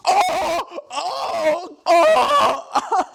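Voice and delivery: hammed-up gasping groans, half laughing; mock pained groans. Bella writhing on the studio floor, hammed-up pained groans. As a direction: fake the transformation pain, breaking into laughter. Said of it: "She's like".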